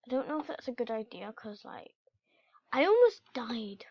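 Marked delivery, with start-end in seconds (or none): none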